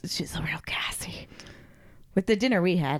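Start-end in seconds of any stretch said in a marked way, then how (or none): 0.1-1.3 hushed whispered aside; whispering. Real, not acted. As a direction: whisper it like a secret. Joking aside about the dog.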